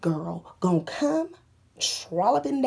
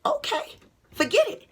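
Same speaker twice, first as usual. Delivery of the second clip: sarcastic okay. Retold or imitated. Retold resigned reply.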